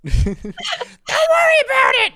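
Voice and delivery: very high, loud, slow squeal; Toad voice. Retold or imitated. Toad reassuring his owner, very high, loud and slow. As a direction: shout it like a tiny excited mushroom.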